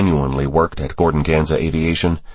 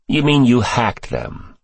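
slow, higher-pitched, mildly disgusted question; sickened disdain; 6 semitones higher, slower than usual. A narrator's reading. Senator challenging Cross over hacking, slow with a touch of disgust. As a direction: say it as if it turns your stomach. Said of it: "as if Cross were feeding the senator a rancid meal".

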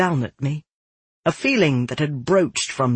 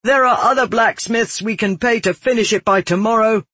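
high, raised voice climbing to a shout; building scream; 8 semitones higher, 4 dB louder than usual. A narrator's reading. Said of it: "Savar screamed as he raised a fist".

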